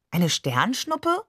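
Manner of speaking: slow, high, puzzled rise; puzzled echo. A narrator's reading. Child repeats the uncle's word, slow and puzzled. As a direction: echo the word back, baffled.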